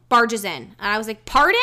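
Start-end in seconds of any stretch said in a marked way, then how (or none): 1.3-1.6 sharp high outraged "Pardon?"; indignant pardon. Retold or imitated. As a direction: snap it back, shocked and offended. Outraged at man barging in.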